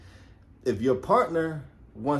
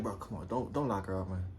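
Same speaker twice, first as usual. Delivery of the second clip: much softer, almost under the breath; quiet inner plea; 8 semitones lower, 11 dB quieter than usual. Retold or imitated. Officer silently begging his partner not to arrest, quiet. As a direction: plead silently, lower and softer. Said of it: "Now I'm thinking that to myself".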